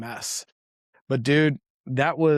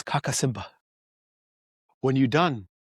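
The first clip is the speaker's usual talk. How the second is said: put-on calm Tanzanian-accented porter. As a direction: gently tell him it's time to go, in a Tanzanian accent. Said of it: "I apologize for how I'm about to fuck this accent up".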